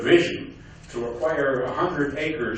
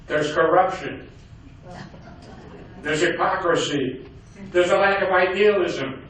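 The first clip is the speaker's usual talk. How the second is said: put-on Yiddish accent; 4 semitones higher than usual. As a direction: list grievances in a Yiddish accent. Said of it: "he talked with a heavy Yiddish accent".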